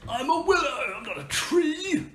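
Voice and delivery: high, indignant tree; offended tree voice. Acted. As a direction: protest with wounded pride.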